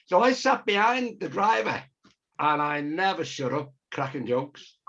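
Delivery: ordinary talk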